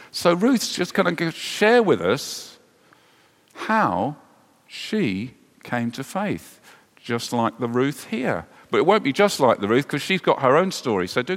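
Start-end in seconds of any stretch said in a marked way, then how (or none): none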